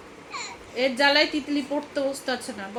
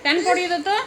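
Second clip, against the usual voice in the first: scolding call; 7 semitones higher, 8 dB louder, much faster than usual. Real, not acted. Mother calling out to her child to put on pants, raised scolding voice.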